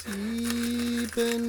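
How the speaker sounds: high, slow rattling ghostly count; death rattle. Acted. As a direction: count slowly like a dying ghost. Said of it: "zählte laut und langsam eine röchelnde Stimme".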